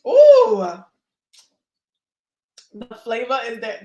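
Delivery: ordinary talk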